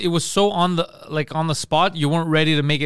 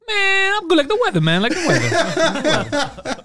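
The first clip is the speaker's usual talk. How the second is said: high, upbeat impression, stretched out; hammed-up positive guy, pitched way up; Peter; 9 semitones higher, slower than usual. Relentlessly positive friend answering a greeting, high exuberant impression. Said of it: "Hey Peter, how you doing?".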